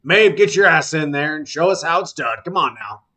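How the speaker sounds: loud coach's order, easing at the end; tactics teacher. Acted. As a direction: holler a student into action. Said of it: "and he says".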